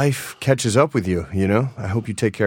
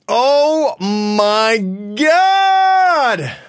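excited outburst; 12 semitones higher, 4 dB louder, much slower than usual. Real, not acted. Excited outburst over yoga.

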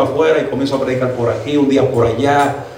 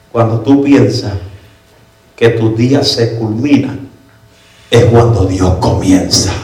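slow, deliberate, dropping lower; proclaimed sermon title; 3 semitones lower, 3 dB louder, slower than usual. Retold or imitated. Preacher revealing the message God gave him, solemn proclamation. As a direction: weigh each phrase, pitch sinking to the end. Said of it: "escribo el tema del mensaje".

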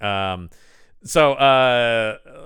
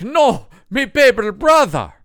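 mock grieving Irish; 11 semitones higher, 8 dB louder than usual. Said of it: "he's like".

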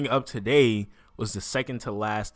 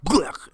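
higher-pitched imitated splat; mock stabbing noise; 6 semitones higher than usual. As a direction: act out a messy stab. Imitating a goblin getting stabbed.

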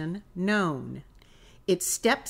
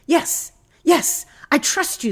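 high, loud, eager assent; eager pleading; 9 semitones higher, 7 dB louder than usual. A narrator's reading. Climber on a branch answering the voice above, eager and urgent. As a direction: agree urgently, like someone hanging on for life.

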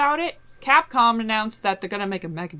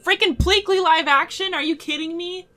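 high, loud disbelief; incredulous outburst; 10 semitones higher, 3 dB louder than usual. Real, not acted. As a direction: shriek it in horrified disbelief. Horrified at live action Bleakley.

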